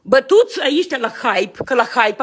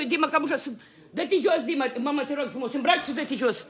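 softer, gentle request; soft pleading; 10 dB quieter than usual. Real, not acted. Father asking Dima to get dressed, soft pleading.

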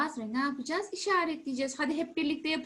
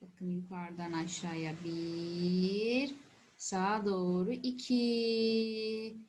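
lower, slow counting demo; slow stroke count; 7 semitones lower, much slower than usual. Shown as a demonstration. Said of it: "Bir daha yapalım mı?".

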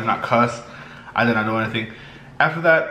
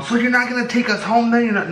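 mock loud protest; 11 semitones higher than usual. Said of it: "she started to get loud".

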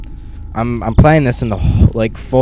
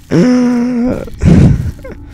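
high shaky nervous laugh; nervous laughter; 13 semitones higher, 3 dB louder than usual. Real, not acted. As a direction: laugh out the fright. Nervous laughter after scare.

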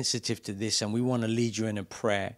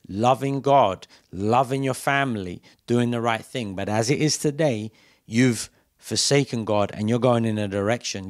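fervent preaching; 5 dB louder than usual. Acted. Preacher pleading with backslidden listeners, louder and impassioned. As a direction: press the point hard on the backslider.

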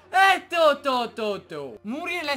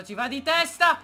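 ordinary talk

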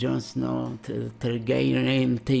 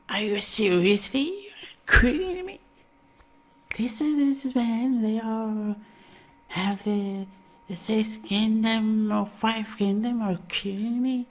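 much higher, squeaky are you kidding me; mock outraged disbelief; 10 semitones higher than usual. Council member scoffs at the six kingdom choice, high mock outrage. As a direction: play a scandalized council member.

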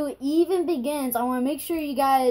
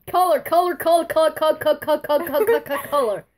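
rapid-fire string, higher and louder; mock-compliant repetition; 3 semitones higher, 4 dB louder, faster than usual. Real, not acted. Giving in to co-host's name correction, teasingly repetitive. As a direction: rattle the name off over and over. Mockingly repeats the demanded name.

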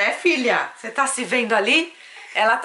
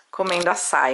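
ordinary delivery

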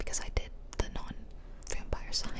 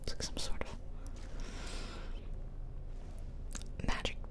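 whispered ASMR. Real, not acted. Player whispers to viewers about the speedrun, ASMR whisper. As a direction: whisper the commentary, no voice at all. Whispered game commentary throughout.

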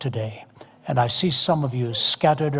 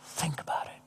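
hushed aside; 16 dB quieter than usual. Real, not acted.

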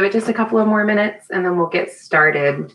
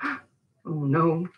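much softer, hushed little gasp of fear; frightened hush; 8 dB quieter than usual. Acted. Mouse hiding from the cat, hushed and frightened.

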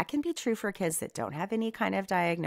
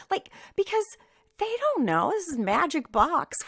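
pitched up high, playful; high mock voice; 12 semitones higher, 3 dB louder than usual. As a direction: squeak it like a puzzled kid. Mocking kids' view, high voice.

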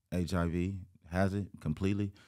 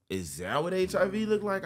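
shocked; 12 semitones higher, 4 dB louder than usual. Retold or imitated. Shocked question to grandpa.

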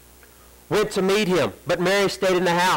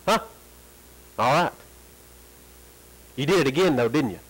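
ordinary delivery

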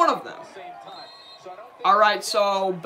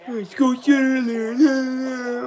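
usual speaking voice

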